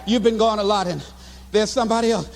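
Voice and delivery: low, halting imitation of a girlfriend; hesitant confession. Girlfriend confessing there's somebody else, hesitant. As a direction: break it gently, low and hesitant.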